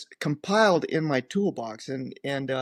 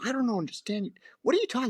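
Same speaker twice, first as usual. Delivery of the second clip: confused protest; 9 semitones higher, much faster than usual. Retold or imitated. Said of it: "I go".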